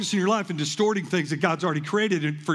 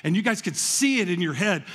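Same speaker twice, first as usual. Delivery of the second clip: ordinary talk, nothing marked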